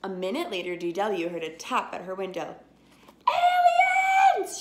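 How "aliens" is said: shrill, very high, very loud scream. A narrator's reading. DW seeing aliens at her window, screaming. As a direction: scream loud enough to wake the neighborhood. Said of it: "she screamed".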